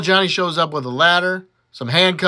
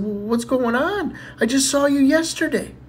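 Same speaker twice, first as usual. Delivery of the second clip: put-on child voice; 9 semitones higher than usual. Johnny asking grandma.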